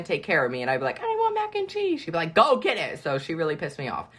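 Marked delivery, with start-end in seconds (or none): 1.0-2.0 put-on very high kid voice; whiny child imitation. Imitates herself as a hungry child. 2.3-3.0 mock command. Imitates big girl's snapped answer.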